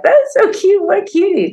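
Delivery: very high-pitched cooing; squeaky retold aside of delight; cutesy gushing. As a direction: gush over an adorable little kid. Gushing to teacher over cute child.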